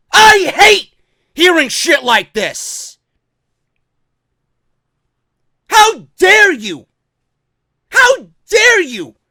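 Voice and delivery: slow, loud, high indignation; outraged. Real, not acted. Fan rebuking the promoter over an insult, outraged. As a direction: stretch each word in real outrage.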